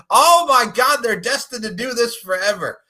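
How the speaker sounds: loud, high excited gasp. Real, not acted. Host thrilled about the rematch, excited outburst. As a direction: burst out with delight.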